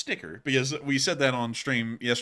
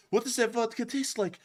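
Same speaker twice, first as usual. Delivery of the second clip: mock Russian soldier; 10 semitones higher than usual. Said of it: "the Russian's like".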